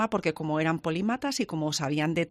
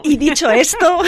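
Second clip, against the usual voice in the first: put-on laughing Mexican accent, pitched up; 7 semitones higher, 12 dB louder than usual. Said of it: "con acento mexicano".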